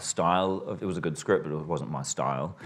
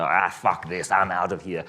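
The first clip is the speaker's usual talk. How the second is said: mildly fed-up, a bit louder; mock storming-off line, pushed out; imitated angry exit; 5 dB louder than usual. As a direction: act out a character quitting in a huff. Character storming out of scene.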